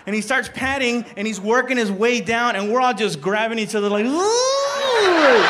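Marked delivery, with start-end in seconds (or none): 4.0-5.5 long, high mock "ooh"; drawn-out ooh. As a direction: let out a loud rising-pitched ooh of suspense. Friends holding back reaction, acted.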